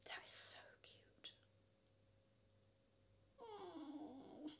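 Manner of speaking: very soft whispered delight; tiny hushed whisper of delight. Real, not acted. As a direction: whisper it, melting over it.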